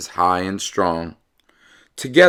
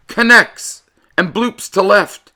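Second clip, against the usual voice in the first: excited call; 11 semitones higher, 4 dB louder than usual. Acted. Exciting play narrated.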